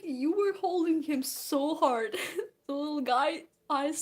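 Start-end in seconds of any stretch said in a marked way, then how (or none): 0.0-2.1 loud, raised retold reproach; mock-scolding emphasis; exasperated mom. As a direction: lightly imitate a mother exclaiming in disbelief. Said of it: "she's like".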